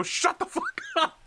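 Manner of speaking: very high acted outburst; exasperated yell. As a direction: cut them off with a high yell. Haller cutting off the subordinate.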